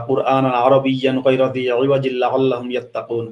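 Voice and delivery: low Arabic Quranic recitation. Retold or imitated. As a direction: intone the verse like a reciter. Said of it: "কোরআনে অবশ্য আছে এরকম কথা".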